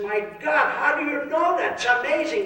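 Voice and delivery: put-on high astonished old man; amazed old man. Old man amazed that his friend knows everything.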